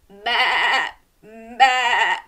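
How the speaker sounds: loud, bleating baa; bleating sheep. Shown as a demonstration. Host bleats like a sheep for the children, loud. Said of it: "Can you baa like a sheep?".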